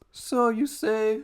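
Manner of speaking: put-on high, slow German doctor accent. Pride dismissing Fury in a German doctor accent. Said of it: "I almost went German doctor again".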